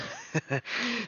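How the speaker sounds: short high giggle; embarrassed laugh. Real, not acted. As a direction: laugh it off sheepishly. Laughs off losing his voice.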